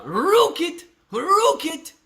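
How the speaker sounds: high, rolled-R hammed-up British; mock British accent. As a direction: exaggerate a squeaky British rocket. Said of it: "That's how you say rocket in Britain".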